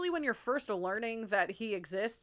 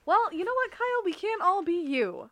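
sassy comeback; 9 semitones higher, 3 dB louder than usual. Pretend. Mock comeback at Kyle.

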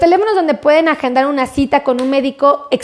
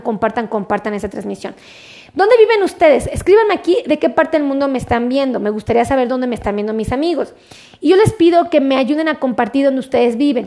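usual speaking voice

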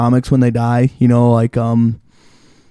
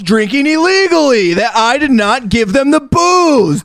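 very high hammed-up outraged voice; mock indignation; 11 semitones higher than usual. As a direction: protest innocence in a squeal of fake shock. Acting out homeowner feigning innocence.

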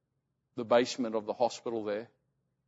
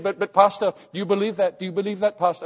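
higher, louder imitated urgent questioning; insistent believing mother; 6 semitones higher, 6 dB louder than usual. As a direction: press the pastor as the fervent mother. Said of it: "spoke English but with a very strong accent".

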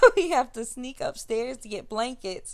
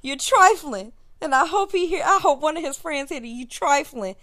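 sister scolding; 7 semitones higher, 7 dB louder than usual. Retold or imitated. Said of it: "my little sister says".

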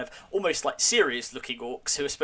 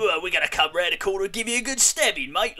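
higher, louder Cockney menace, hammed-up; Cockney thug; 6 semitones higher, 4 dB louder than usual. Podcaster mocking orcs, in a hammed-up Cockney threat. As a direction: threaten in a mock Cockney accent, like a street tough. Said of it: "Cockney, you know".

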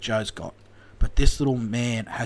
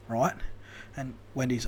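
usual speaking voice

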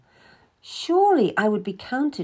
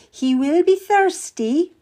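higher, slow and courteous; queen's order; 10 semitones higher, 5 dB louder, slower than usual. Acted. Queen telling Kath to serve the Cardinal, higher and slow. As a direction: lift the word be, a polite royal order. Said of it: "she said".

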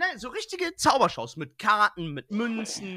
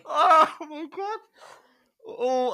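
cracking up mid-line; 8 semitones higher, 6 dB louder, much slower than usual. Real, not acted. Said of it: "der Lachanfall hier".

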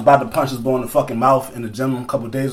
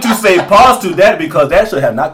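loud, high put-on African accent; laughing retreat into a mock African accent; 8 semitones higher, 8 dB louder than usual. Host backing off an awkward phrase, loud mock African accent. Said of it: "say that with my African accent".

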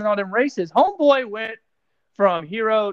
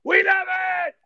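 hyped shout; 9 semitones higher than usual. Real, not acted. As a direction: belt it out like a race announcer. Hyped call about pole position.

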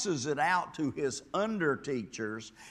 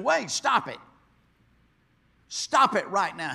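sharp, louder order to stop; stern command; 5 semitones higher, 7 dB louder than usual. Acted. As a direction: halt them firmly. Ezra halts the weeping people.

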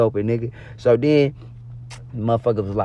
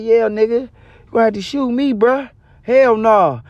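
defiant refusal; 8 semitones higher than usual. Retold or imitated.

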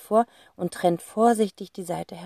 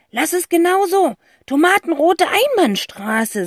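high, louder, slow and excited; eager boy voice; 11 semitones higher, 6 dB louder than usual. Acted. Boy explaining the plan to his sister, eager high voice. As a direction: play the boy with a bright idea, higher. Zack figure voice.

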